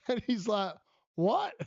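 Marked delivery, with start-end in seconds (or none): 1.1-1.5 a sharp high what of disbelief; surprised what. Retold or imitated. Retelling a colleague's stunned reaction, high and surprised. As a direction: jump up in pitch, stunned.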